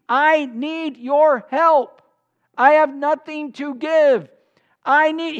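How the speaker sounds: loud, high, drawn-out plea; pleading. Acted. Man begs his neighbor at night, pleading loudly. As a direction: cry out slowly for help, stretching the words. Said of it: "continued in his pleading".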